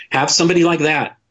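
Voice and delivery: ordinary talk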